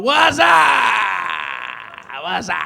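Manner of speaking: loud boozy "What's up?" yell, pitch way up; drunk husband's greeting. Retold or imitated. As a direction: holler it like he's stumbling back from the bar. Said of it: "talking about".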